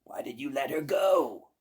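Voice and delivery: loud furious shriek; witch screaming. Acted. Baba Yaga screaming at her cat. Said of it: "the witch screamed".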